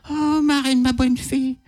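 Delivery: high mock-sweet old Cinderella; Cinderella's voice. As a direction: gush with delight at the fairy. Old Cinderella greets her fairy godmother.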